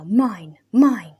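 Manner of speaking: rising to a loud cry on mine; possessive cry. A narrator's reading. Hermit claiming the sword as his own. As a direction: clutch the word mine with greed.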